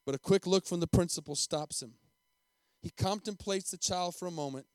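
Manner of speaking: usual speaking voice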